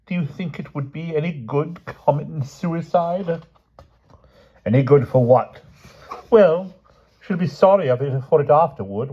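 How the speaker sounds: morbid king. Acted. King moans to Grumor about dying, high and self-pitying. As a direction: wallow in gloomy self-pity.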